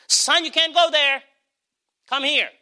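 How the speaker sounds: hint of a caring father calling; lightly voiced fatherly call; loving God to son. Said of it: "his loving arms will say to you".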